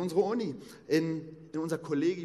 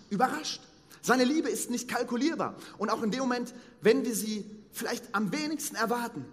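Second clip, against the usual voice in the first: raised, higher, louder fervent sermon voice; preaching at full force; 7 semitones higher, 5 dB louder than usual. Acted. Preacher to the congregation about Abraham, fervent preaching. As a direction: proclaim it like a revival preacher. Preaches God's surprising love.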